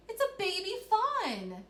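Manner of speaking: high, loud, drawn-out delight; excited exclamation. Shown as a demonstration. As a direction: read it as a thrilled discovery. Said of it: "I made my voice sound excited".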